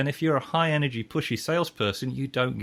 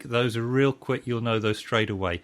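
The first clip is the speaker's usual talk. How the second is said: ordinary delivery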